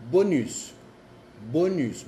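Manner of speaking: bonus with a clear n and French u; French bonus, plain o and n; French bonus, not nasal. Shown as a demonstration. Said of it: "Por ejemplo".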